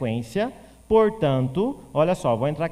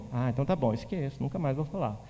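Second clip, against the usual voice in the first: sheepish student; 3 semitones lower, 6 dB quieter, faster than usual. Pretend. Voiced student accepting the correction, quietly embarrassed. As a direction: mumble it, chastened and softer.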